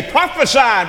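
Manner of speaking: high, loud mock command; mocking taunt. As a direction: jeer it as the mockers would. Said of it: "to smack him and mock him and say".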